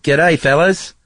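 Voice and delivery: mock Australian greeting, pitched high and loud; a playful Aussie "G'day, fellas"; Australian accent. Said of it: "That's pretty good".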